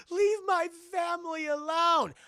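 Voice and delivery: high, slow, loud plea; hammed-up high pleading voice; pleading girl. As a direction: beg the spirit in a child's voice. Voiced plea against the spirit.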